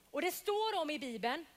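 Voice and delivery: usual speaking voice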